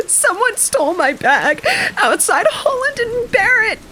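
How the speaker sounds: high, fake-weeping old lady. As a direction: weep it out, high and theatrical. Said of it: "weeps Elizabeth".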